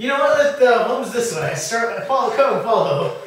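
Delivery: high, loud eager leading; excited. Acted. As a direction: call them along with gusto. Character leads the way grandly.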